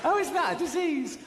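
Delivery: high, whiny fake invalid; mock sick moaning. Mocking the woman who calls obesity a disease, high whiny fake illness. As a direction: ham up being terribly ill.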